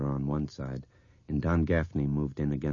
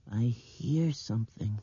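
put-on slow, higher child's voice; hushed little girl; 7 semitones higher than usual. A narrator's reading. Girl at the window hearing something, hushed and slow. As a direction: say it slowly as a listening girl. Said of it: "These whispered words".

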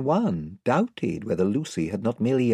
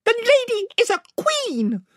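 very high, stately little voice; Reepicheep; 4 dB louder than usual. A narrator's reading. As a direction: correct them in a piping voice. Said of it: "said Reepicheep".

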